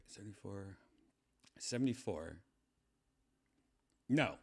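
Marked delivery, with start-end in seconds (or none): none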